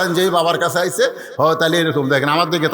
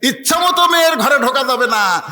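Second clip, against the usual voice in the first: full-force preaching; 9 semitones higher, 4 dB louder than usual. Acted. Preacher laying down a rule about entering a daughter's room, full-force preaching. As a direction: proclaim it high to the crowd.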